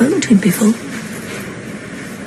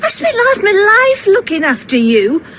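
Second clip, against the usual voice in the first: tearful self-pity; 6 semitones higher than usual. Acted. Mother complains to her grown kids about her sacrifices, tearful and self-pitying. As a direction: voice cracking into a mock sob on the complaint.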